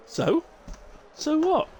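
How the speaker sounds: high, loud, defiant comeback; defiant retort. Acted. Streamer bantering back at chat with a defiant retort. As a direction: fire back a cheeky challenge.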